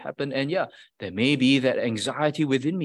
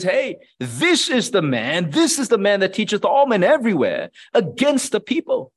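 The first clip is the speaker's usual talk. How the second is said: loud, higher accusing voice; accusing crowd; 6 semitones higher, 4 dB louder than usual. Retold or imitated. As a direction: point the finger and denounce him. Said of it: "they pointed at Paul and says".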